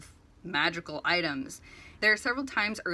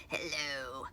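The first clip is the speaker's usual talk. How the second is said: creepy mysterious voice; 4 semitones lower than usual. Retold or imitated. Kidnapper threatens Artemis over the phone, creepy low voice. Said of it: "all creepy and mysterious".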